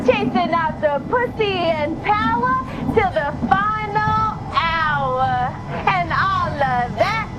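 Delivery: rhythmic, pointed slam poem reply; spoken word poetry. Acted. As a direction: fire the verse back at him.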